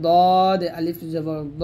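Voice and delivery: slow, heavy rounded দ, held long; thick, full letter. Shown as a demonstration. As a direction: fill the mouth and stretch দ. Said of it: "মোটা হবে".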